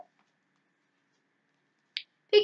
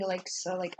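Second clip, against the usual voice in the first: ordinary delivery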